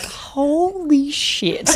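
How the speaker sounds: high, very drawn-out awed reaction; stunned awe. Retold or imitated. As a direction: stretch it out, floored by what you saw. Retelling awe at co-star's performance.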